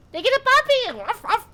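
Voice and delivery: very high, loud delighted squeal; excited squeal. Real, not acted. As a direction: squeal with puppy joy. Excited about dog in game.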